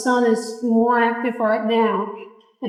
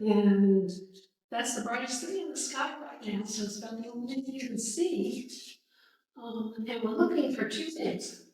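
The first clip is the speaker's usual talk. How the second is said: quiet voice; 7 dB quieter than usual. Real, not acted. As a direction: speak softly, barely projecting. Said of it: "she's not very loud".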